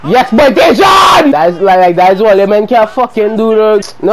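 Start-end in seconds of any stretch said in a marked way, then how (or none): 0.1-1.3 celebration. Real, not acted. Celebrating a goal.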